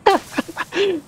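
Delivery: short high laugh; helpless laugh. Real, not acted. Player missing the jump, high laughing. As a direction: break into a high cackle.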